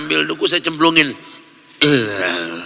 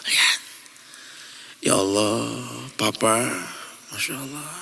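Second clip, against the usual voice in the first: soft, lower, hushed sermon; tender preaching; 7 semitones lower, 6 dB quieter than usual. Acted. Preacher softly urges children to see sleeping parents, tender hushed voice. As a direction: bring it down to a tender hush.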